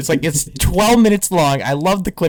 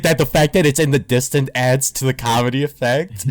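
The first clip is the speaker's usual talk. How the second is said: normal talking voice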